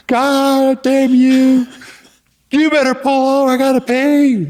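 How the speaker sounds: high, loud, very slow drawn-out curse; furious Paul. Retold or imitated. Paul cursing the driver for missing the exit, slow high furious squeal. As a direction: stretch the curse out in a strangled squeal.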